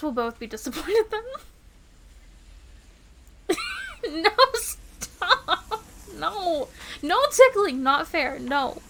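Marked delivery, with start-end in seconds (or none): 3.5-4.2 high-pitched burst of giggles; tickled squeal of laughter. Acted. Partner tickles the speaker, high squealing laugh. 4.2-8.8 loud, high, giggly pleading; playful tickled protest. Acted. Partner starts tickling the speaker, loud playful protest.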